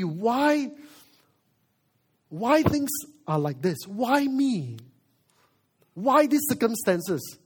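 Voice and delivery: high, pleading-angry questions thrown at God; angry protest. Acted. Believer angrily asking God why me, acted protest. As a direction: demand answers in an acted outburst. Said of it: "You are angry".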